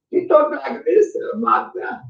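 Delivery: put-on high, slow Hungarian accent. Showing viewers how Magda talked, high Hungarian accent. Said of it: "an Hungarian accent".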